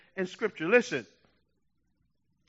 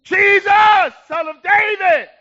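full-force high cry for help; loud, high acted plea of a blind beggar; yelling out; 11 semitones higher, 12 dB louder, slower than usual. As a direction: yell it over the crowd, desperate to be heard. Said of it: "but he yelled out all the more".

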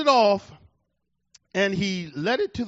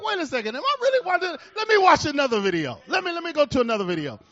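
higher, quick and amazed; excited disbelief; 6 semitones higher, 3 dB louder, faster than usual. Retold or imitated. Preacher reliving his amazement at the video. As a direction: rush it out, can't believe it.

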